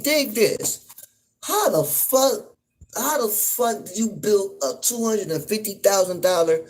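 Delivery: can't believe anyone built it like that; incredulous rant. Real, not acted. Incredulous rant about the submarine.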